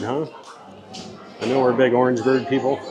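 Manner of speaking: usual speaking voice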